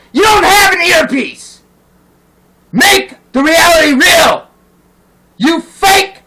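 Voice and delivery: loud, high-pitched ranting at the politicians; real angry yelling; angry shouting. Real, not acted. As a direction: shout them down. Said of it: "but I'm pissed off".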